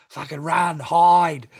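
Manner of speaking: loud, high, hammed-up call to flee; mock alarm, shouted high; mock panicked yell. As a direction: yell it like the police are at the door. Mock panic at Gestapo knock.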